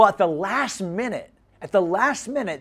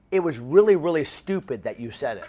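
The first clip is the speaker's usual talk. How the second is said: ordinary delivery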